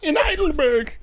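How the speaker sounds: high mock German punchline; terrible German accent. S1 swaps in a German town, terrible German accent. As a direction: cap the parody in a bad German accent. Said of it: "I got a terrible German accent".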